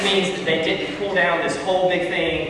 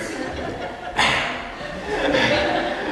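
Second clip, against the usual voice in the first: high breathy laugh; 4 dB quieter than usual. Real, not acted. Laughing at spanking story.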